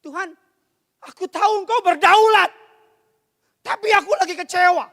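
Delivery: high, loud cry to God; impassioned lament. Shown as a demonstration. Believer crying out disappointment to a sovereign God, impassioned lament. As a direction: demonstrate lament as a forceful, anguished prayer. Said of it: "Itu ratapan".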